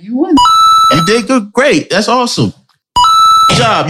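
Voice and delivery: high, cheering praise; excited hype-up. Real, not acted. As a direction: pump him up. Co-host hyping him up.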